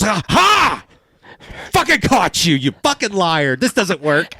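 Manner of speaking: high, gloating imitation of his wife; mocking wife. Wife catching him upset, high gloating mockery. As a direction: crow over catching him out. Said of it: "she goes".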